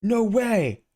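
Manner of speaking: loud, high delighted cry; amazed yell at the meeting; excited shout. Acted. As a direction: burst out in thrill. Losing grip in shock.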